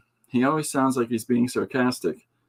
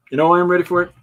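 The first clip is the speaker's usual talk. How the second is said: excited; 8 semitones higher, 8 dB louder, much faster than usual. Real, not acted. Hyped exclamation.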